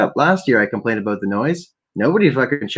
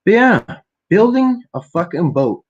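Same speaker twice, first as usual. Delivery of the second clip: excited outburst; 9 semitones higher, 5 dB louder than usual. Real, not acted.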